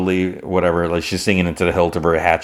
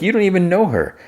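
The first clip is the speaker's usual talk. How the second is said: indignant retort; 13 semitones higher, faster than usual. Retold or imitated. Quiet snaps at John.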